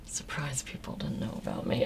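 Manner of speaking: whispered to herself while thinking; whispering. Real, not acted. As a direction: mull the question over in a whisper. Repeating the question to herself.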